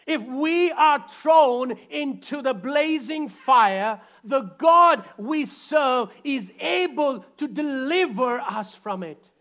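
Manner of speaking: slow, loud declaration; preaching with force. Acted. Preacher voicing the three men before the king. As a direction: proclaim it as a firm statement of faith.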